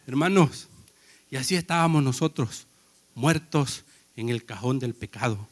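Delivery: usual speaking voice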